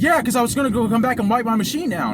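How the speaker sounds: retold defensive outburst; getting loud. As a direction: imitate a man arguing back loudly. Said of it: "And he's getting loud".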